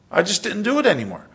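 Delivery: ordinary talk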